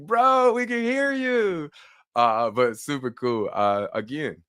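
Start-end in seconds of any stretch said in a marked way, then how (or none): 0.0-1.7 mocking chat comment. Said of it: "the chats were going off like".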